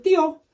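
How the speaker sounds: high-pitched, put-on "Dio"; affected Dio. Retelling a question about the brand, affected high "Dio". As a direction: say "Dio" up high in a showy, not normal voice. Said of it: "say it in a normal voice".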